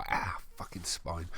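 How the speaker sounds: hushed disgusted whisper; whispered aside. Real, not acted. Reader recoiling at the coffin description. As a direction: mutter it under your breath.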